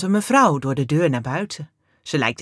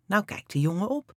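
plain reading voice

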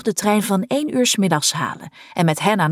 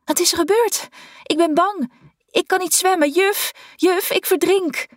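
put-on high, panicky children's voices calling out; small girls in the dark, terrified and pleading; frightened girls crying out; 12 semitones higher than usual. A narrator's reading. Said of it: "angstige stemmen". (hoge, angstige kinderstemmetjes in paniek)

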